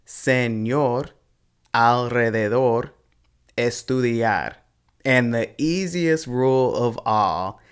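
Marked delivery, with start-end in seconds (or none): 0.0-1.1 Señor as se-ÑOR; stress on last syllable. Shown as a demonstration. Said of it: "then you stress the last syllable". 1.7-2.9 alrededor as al-re-de-DOR; stress on last syllable. Shown as a demonstration. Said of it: "then you stress the last syllable". 3.6-4.5 estudiar as es-tu-DIAR; stress on last syllable. Shown as a demonstration. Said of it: "then you stress the last syllable".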